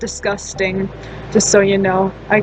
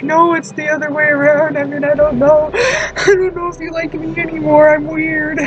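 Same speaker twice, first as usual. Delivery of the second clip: laughing; 6 semitones higher than usual. Retold or imitated. Own nervous reply, laughing.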